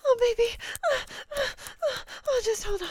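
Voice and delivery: loud, high, moaning delivery; impassioned and carried away; worked-up passion. Acted. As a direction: let the voice climb with mounting arousal. Acted passion in roleplay.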